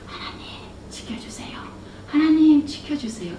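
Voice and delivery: hushed, pleading whisper; earnest whispered prayer. Retold or imitated. Mother praying for her daughter over the phone, earnest whisper. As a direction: breathe the prayer in a hush. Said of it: "엄마의 간절한 목소리가 들리는".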